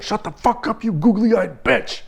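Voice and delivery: high, strained insult, drawn out at the end; acted-out furious yell; imagined angry outburst. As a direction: lash out with the insult you held back. Imagined insult at yelling wife.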